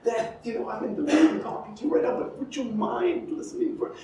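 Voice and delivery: high, hushed, timid apology; mock a meek teacher, small and soft; meekly apologetic. Arts teacher apologizing for existing, meekly apologetic. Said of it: "like, apologetic that they exist".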